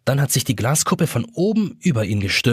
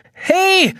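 very high shouted call; 4 dB louder than usual. A narrator's reading. Jonas trapped under glass calling out, high shout. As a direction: shout for attention. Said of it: "ruft Jonas".

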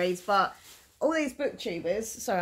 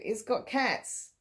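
ordinary talk

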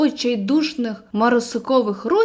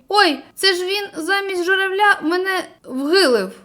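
pained whimper; 6 semitones higher than usual. Acted. Hit on head, last thought.

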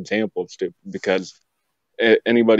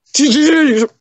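very high, loud holler; loud call-out; 7 dB louder than usual. Pretend. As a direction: holler it out at a sudden high pitch. Calling out after "hold up.

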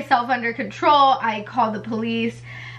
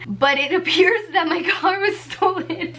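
higher voice breaking into a laugh; 5 semitones higher than usual. Real, not acted. Telling viewers about the stolen car, laughing. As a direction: laugh through the admission. Laughs while admitting the theft.

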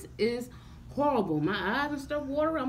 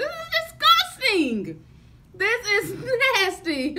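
disgusted outcry; 11 semitones higher, 8 dB louder, slower than usual. Real, not acted. Tasting disgusting baby food.

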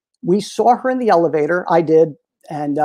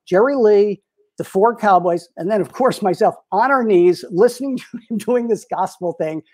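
usual speaking voice

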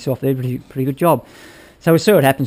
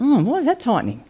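retold startled question, pitched much higher; puzzled surprise; 11 semitones higher than usual. Recalling the bolt jamming during a test run, surprised. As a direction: voice jumps up in puzzled alarm. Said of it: "And I stopped and went".

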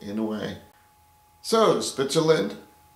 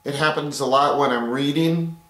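ordinary talk

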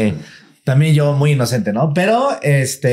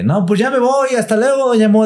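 put-on high, sing-song farewell of a young maid; young maid's goodbye; 12 semitones higher than usual. The young maid saying goodbye to doña Mo, high and sing-song. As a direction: sweetly chirp goodbye like the girl leaving. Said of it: "la morrita así de".

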